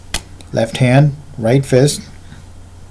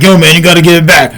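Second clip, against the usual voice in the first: fast, raised imitation of a pushy kid; quick, higher, mock-urgent street voice; rushing tough kid; 4 semitones higher, 4 dB louder, faster than usual. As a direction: mimic him rushing in with a demand. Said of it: "Then he comes rushing".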